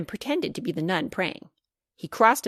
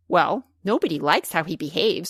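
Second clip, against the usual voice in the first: plain reading voice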